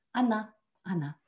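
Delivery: Anna said with both vowels as clear A; unstressed o said as a in Anna. Shown as a demonstration. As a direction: say Anna, open A sounds, like она. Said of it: "ça se prononce toujours A".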